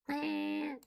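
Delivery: a long sleepy yawn sound. Real, not acted. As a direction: let out a yawn. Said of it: "Again with the yawning".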